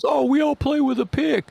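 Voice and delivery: mock the people who wave off pick players. Fan mocks critics of pick-playing bassists in a high sneering voice.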